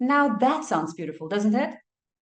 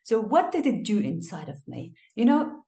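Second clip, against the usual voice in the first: ordinary talk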